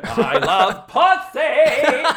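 very high, slow, laughing mock declaration. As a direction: drag it out high and hammy, laughing.

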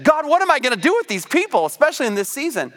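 high, fast frustrated plea; exasperated plea. Retold or imitated. A leader pleading with God about their people, high and quick. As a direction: rush it up high like a worn-out leader. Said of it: "you've asked that question".

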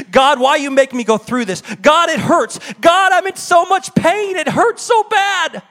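mock-anguished lament, slowing at the end; anguished cry to God. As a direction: let the hurt climb until the voice cracks upward. Acted anguished complaint to God.

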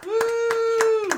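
loud high cheer; excited whoop. Real, not acted. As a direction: whoop it up for the crowd. Guest cheers his arrival.

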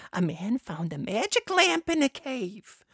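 ordinary delivery

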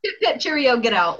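hammed-up posh British send-off; mock the Brits kicking him out; mock British accent. To friends, mocking British officials, hammed-up British accent.

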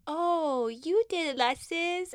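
put-on high little-kid voice; imitating the kids. Kids telling Alexis she taught them, high childlike voice. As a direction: point the finger like a delighted child. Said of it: "they're like".

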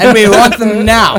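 loud, high mock demand; Withnail. Finishing a friend's film quote, loudly demanding. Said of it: "very alternative Withnaw and I scene".

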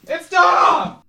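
high, loud mock-dramatic yell; excited shout. Shouted answer to the host's question.

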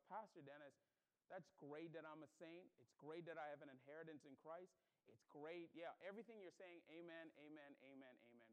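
low, soft placating agreement; imagined inner thought. Retold or imitated. Churchgoer silently answering the pastor, imagined inner thought. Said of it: "you're thinking to yourself".

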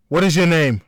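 loud, high sharp question; firm demand. A narrator's reading. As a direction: demand her name. Melissa interrogates the woman.

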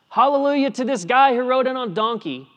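mock contrast. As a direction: toss it off high and loud as the wrong cheer.

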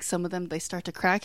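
normal talking voice

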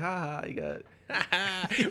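high drawn-out jeering laugh; slow mock laughter. Friends jeering at the kicked guy, slow laughter. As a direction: ham up a slow taunting laugh. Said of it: "his other friends are just like".

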